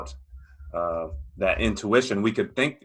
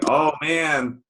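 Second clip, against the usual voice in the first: higher, drawn-out mock dread; anxious, panicky; 6 semitones higher than usual. Guest voicing a rafter who sees the mist, mock anxious. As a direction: act out a worried thought. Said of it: "all anxious and panicky thinking".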